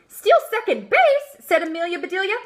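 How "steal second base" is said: shocked question. Acted.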